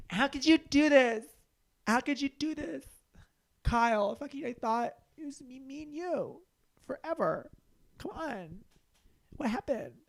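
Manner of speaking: hammed-up girlfriend pleading with cheating Kyle; heartbroken girlfriend.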